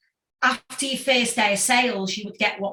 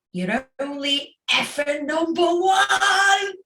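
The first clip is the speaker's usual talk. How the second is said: slow, higher, delighted announcement of the win; excited news; 6 semitones higher, 3 dB louder, slower than usual. Retold or imitated. Manager breaking the chart news to the band, excited. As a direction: spell out the big news with glee.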